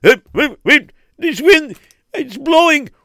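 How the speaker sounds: high, loud panicked cries; screaming while lifted in the air; terrified. Acted. Carried off by the wind.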